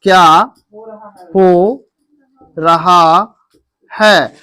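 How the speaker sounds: "क्या हो रहा है" with a pause after each word. Shown as a demonstration. Teacher spelling out the Hindi phrase word by word for the class. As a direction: space out "क्या", "हो", "रहा", "है" one at a time. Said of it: "उसको English में मैंने सिखाया था".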